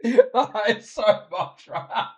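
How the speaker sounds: laughing through the words; can't hold back a laugh. Real, not acted. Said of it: "I'm laughing again".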